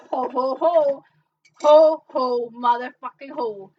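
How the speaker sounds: booming, very loud ho-ho-ho; hammed-up, shouted festive laugh; mock Santa bellow. Mock Santa call in Christmas bit.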